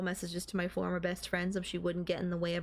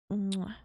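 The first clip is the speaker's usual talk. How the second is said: kiss sound. Acted. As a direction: plant a playful kiss. Kisses her boyfriend.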